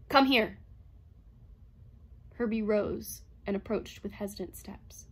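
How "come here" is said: raised-voice, commanding order; loud, stern summons; cold imperative. A narrator's reading. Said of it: "voice into a cold imperative".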